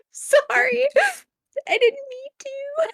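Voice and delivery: high-pitched mock apology; sheepish apology. Player apologising to husband after D and D, high and sheepish.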